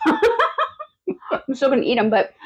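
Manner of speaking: laughing. Real, not acted. Disappointed pickle, laughs while eating.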